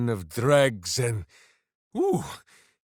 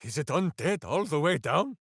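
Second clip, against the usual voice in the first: usual speaking voice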